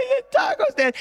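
high, wailing sob; imitated sobbing. Brother sobbing over the taco stand, high wail. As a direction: cry it out like a crushed kid. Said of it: "he just starts sobbing".

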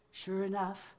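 ordinary delivery

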